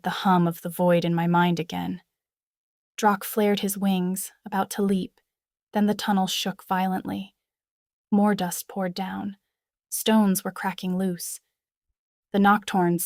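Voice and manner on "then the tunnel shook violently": ordinary delivery, nothing marked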